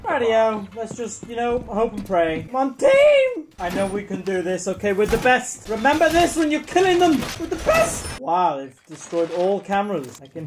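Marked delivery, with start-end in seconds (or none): 2.4-3.5 high, drawn-out team rally; rallying cry. Real, not acted. 7.4-8.2 squeaky-high pep talk; high-pitched boast. Real, not acted. Same words repeated in high voice.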